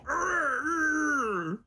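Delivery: troll whimper. Retold or imitated. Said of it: "As the troll".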